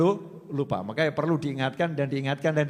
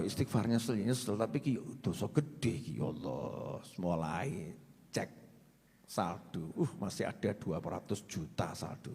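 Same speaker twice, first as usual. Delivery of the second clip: much lower, softer, half-hearted mumble; muttering to himself; 11 semitones lower, 11 dB quieter than usual. Retold or imitated. Man late for dawn prayer mumbles low and softly to himself.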